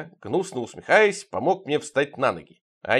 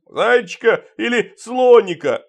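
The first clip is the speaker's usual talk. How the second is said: loud, high, slow holler; roaring Father Frost; 9 semitones higher, 8 dB louder, slower than usual. A narrator's reading. Father Frost calls after departing guest about next year's gift, roaring. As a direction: bellow it after someone leaving. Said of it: "рявкнул вдогонку Дед Мороз".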